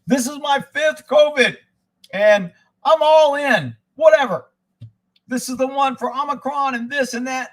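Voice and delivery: proud radio guy. Retold or imitated. Mocking a radio caller proud of his fifth shot, boastful and loud. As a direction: ham up a smug guy bragging about his booster. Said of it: "He's all proud".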